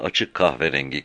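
ordinary delivery

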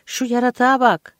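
high, loud, frantic exclamation; crazed voice. A narrator's reading. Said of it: "sesi çılgın gibi çıktı".

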